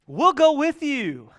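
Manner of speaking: high, loud and slow, the disciples jumping in; eager disciples. Acted. Disciples answering Peter, eager and loud. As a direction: call it out like keen fishermen tagging along.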